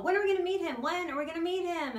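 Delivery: loud, high mimicry of nagging parents; pestering parents. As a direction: imitate eager parents pestering, high and insistent. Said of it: "now they're all".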